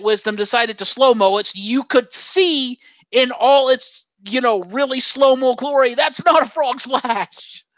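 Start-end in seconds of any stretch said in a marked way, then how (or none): 5.9-7.6 high, drawn-out mock outrage; indignant protest. Retelling a reaction to the slow-mo replay, exasperated and drawn out. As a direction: stretch out the exasperated objection. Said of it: "I'm like".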